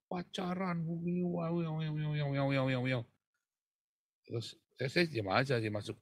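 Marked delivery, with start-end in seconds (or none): 0.1-3.1 higher-pitched nagging aunt voice; scolding aunt. Retold or imitated. Aunt scolding her dating nephew, higher nagging voice. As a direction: nag on and on with the wah wahs. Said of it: "Tante saya itu setiap hari ngomelin saya".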